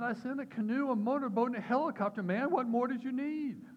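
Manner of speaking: very high, loud punchline rebuke; hammed-up exasperated Jesus; acted exasperation. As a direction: list it off incredulously, stretching the final question. Exasperated Jesus answers in story.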